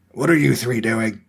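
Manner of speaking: a lightly voiced snarl from a grumpy caretaker. A narrator's reading. Caretaker snarling at the three girls. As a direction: growl the question at the kids. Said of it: "the man snarled".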